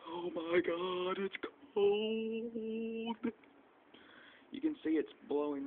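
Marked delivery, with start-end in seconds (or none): 0.1-3.3 slow, high, shivering groan; shivering drawn-out moan. Real, not acted. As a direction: stretch it out, freezing. Standing in freezing blizzard.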